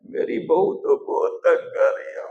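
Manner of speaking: hammed-up voiceless blubbering; mock sobbing. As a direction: pretend crying, words broken up by sobs. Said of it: "भगवान के सामने रोते हैं".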